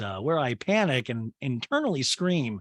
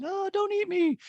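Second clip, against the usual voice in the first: much higher, pleading little food voice; sad meatball voice. Retold or imitated. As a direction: beg like a tiny meatball. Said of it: "with the sad meatballs".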